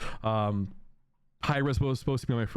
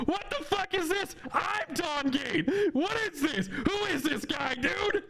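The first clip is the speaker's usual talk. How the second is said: mock outraged squeal; slower than usual. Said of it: "and I'm like".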